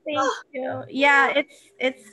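moved thank-you, close to tears; touched. Real, not acted. Author to a moved reader praising her book, touched.

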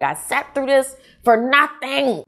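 high, slow, drawn-out complaint; exasperated reaction. Retold or imitated. Reader reacting to the book's ending, exasperated.

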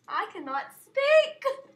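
laughing shout of self-exasperation; exasperated yell. Real, not acted. Gamer after fumbling the word world, exasperated yell.

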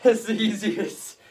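usual speaking voice

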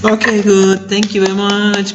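high-pitched, encouraging teacher praise; upbeat praise. Real, not acted. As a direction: praise the pupil in a lifted, drawn-out voice. Teacher praises pupil after word drill. (ชมนักเรียนด้วยเสียงสูงสดใส)

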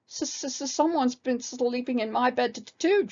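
high, stuttering little bear; high childlike stutter. A narrator's reading. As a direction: stammer the first and last sounds like a frightened cub. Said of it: "stuttered Baby Bear".

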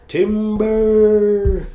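loud high shout of timber; timber call. Acted. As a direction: yell timber like a falling tree. Warns Gil, tree about to fall.

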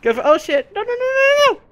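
high-pitched alarmed yelp; rising into a squeal of panic; panicked. Real, not acted. Player hit by sudden trouble in game, panicked squeal.

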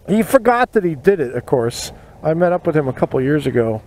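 plain reading voice

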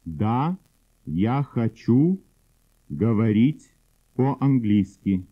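normal talking voice

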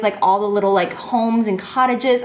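ordinary delivery

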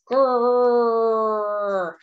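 playful rhino growl; mock growl. The big rhino facing Fred, playful growl.

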